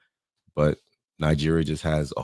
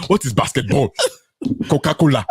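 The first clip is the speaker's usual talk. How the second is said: mock Ghanaian friend; 7 semitones higher, 4 dB louder than usual. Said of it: "She said".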